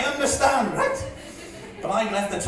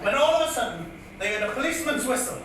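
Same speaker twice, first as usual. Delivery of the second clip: projected, singsong ballad telling; comic poem recitation; 4 semitones higher than usual. Acted. Comedian reciting his dad's old bit as a tribute, declaimed comic verse. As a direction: declaim the rhyme to a crowd.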